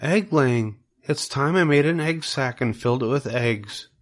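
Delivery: a gentle hint of a wise spider, hesitant; Charlotte the spider.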